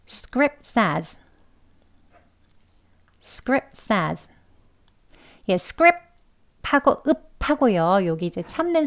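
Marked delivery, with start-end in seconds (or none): 0.1-4.3 script says run together, the t of script dropped; linked conversational. Shown as a demonstration. Teacher shows the students the linked casual version. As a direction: join script into says without the t. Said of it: "이어서 두 번 해볼게요".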